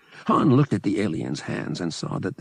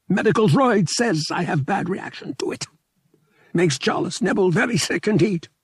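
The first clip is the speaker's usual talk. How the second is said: high, louder small alien voice, weary and unhappy; a sad small alien pilot, pitched up high; mournful little alien; 13 semitones higher, 4 dB louder than usual. A narrator's reading. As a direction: fragile alien pilot lamenting, high and pressed. Alien explains his illness.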